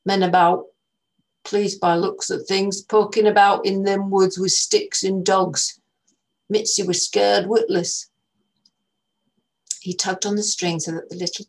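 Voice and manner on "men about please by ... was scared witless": put-on slow, louder northern English old man; old northern English man warning the girls; northern English accent. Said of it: "He spoke slowly and with an accent from further north".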